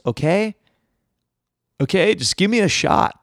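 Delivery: ordinary talk, nothing marked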